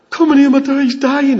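high, loud acted cry for help; desperate plea. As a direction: plead loudly as a frantic parent. Acts a desperate parent pleading.

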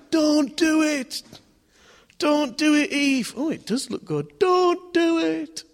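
high, pleading shouts at Eve; crowd shouting. Acted. Millions in history crying out to Eve, high desperate shouting. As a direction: cry out to stop her, high and loud. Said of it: "Everyone shouting out".

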